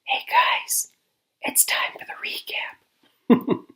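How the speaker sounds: high breathy whisper, slowed right down; playful whisper. Pretend. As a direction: hush it like a secret announcement. Whispered recap for quiet film.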